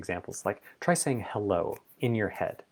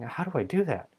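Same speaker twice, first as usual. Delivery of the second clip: higher, fretting roommate; chatty roommate voice; 8 semitones higher than usual. Retold or imitated. The mind as a roommate, higher and fretting. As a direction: play the anxious inner chatterer. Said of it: "It's your roommate saying".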